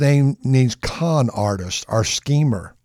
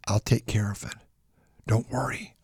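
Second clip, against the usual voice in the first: much softer, hushed reassurance; calm him down in a low hush; soothing mother; 3 semitones lower, 9 dB quieter than usual. Acted. Mother quietly reassuring Jacob, soft and hushed.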